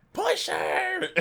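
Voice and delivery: high-pitched, drawn-out eager cry; sincere, funny cheer. Retold or imitated. Sweet older guildmate urging everyone to push, sincere high cry. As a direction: imitate a sweet older lady earnestly urging. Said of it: "the way she said it was so sincere".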